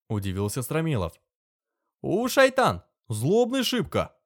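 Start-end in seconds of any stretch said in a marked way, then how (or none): 2.0-4.1 much higher, loud Tatar servant insisting; Tatar accent. Acted. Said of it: "убеждённо заявил татарин".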